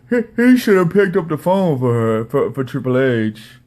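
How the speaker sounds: loud, stammering mock impression; Don Tony. Host mimicking podcaster's phone complaint, stammering mocking voice. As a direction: stumble through it in an exaggerated put-on voice. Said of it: "Don Tony's like".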